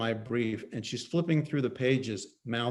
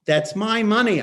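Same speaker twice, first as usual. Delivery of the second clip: louder, higher yell; 8 semitones higher, 7 dB louder than usual. Acted. Husband yelling at his wife over his money. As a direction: shout it at her. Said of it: "I yell".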